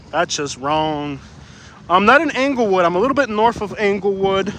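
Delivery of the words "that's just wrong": slow, drawn-out disapproval. Pretend. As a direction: stretch each word, disapproving. Final slow repeat of scolding.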